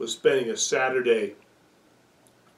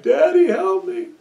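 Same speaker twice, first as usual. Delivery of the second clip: put-on much higher, louder child's plea; crying little boy; 10 dB louder than usual. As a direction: wail like a stuck toddler. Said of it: "he cried".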